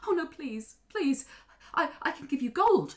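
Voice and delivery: high, desperate bargaining; acted frantic mother; pleading young queen. Queen pleads for her baby.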